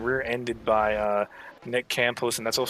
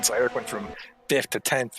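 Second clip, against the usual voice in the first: ordinary talk, nothing marked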